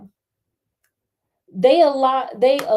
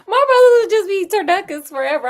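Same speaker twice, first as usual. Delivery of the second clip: higher, louder, fast wail; mock despair; 10 semitones higher, 7 dB louder, faster than usual. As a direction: wail it like a hammed-up tragedy. Mock lament about the brothers.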